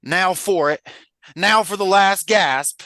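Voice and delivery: high, loud Southern country voice; rally them in a rousing Southern drawl; Sam. Acted. Reader voicing the hobbit from the book, rousing Southern voice. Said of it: "I'm gonna do my Sam voice".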